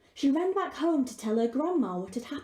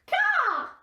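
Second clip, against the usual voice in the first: high, squawky bird voice with caws; a cheerful raven chattering; talking raven; 5 dB louder than usual. Acted. Raven answers.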